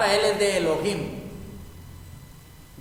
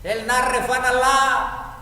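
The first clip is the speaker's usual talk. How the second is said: a fervent, higher, louder, slow plea; Moses pleading; 6 semitones higher, 4 dB louder, slower than usual. Acted. Moses begging God to heal Miriam, fervent slow plea. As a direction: cry out to God like Moses praying for his sister. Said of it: "Eso fue lo que le dijo Moisés".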